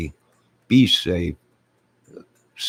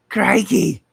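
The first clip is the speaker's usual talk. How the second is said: Crikey! yelled much higher and louder in a hammed-up Australian accent; mock Aussie exclamation; 14 semitones higher, 8 dB louder than usual. Streamer greeting an Australian viewer in a hammed-up Australian shout. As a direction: belt it out like a cartoon Australian. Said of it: "from Australia".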